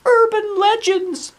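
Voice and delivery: very high, loud insistent voice; insist, shutting the idea down. Acted. Girl insists to the boys there's no dungeon.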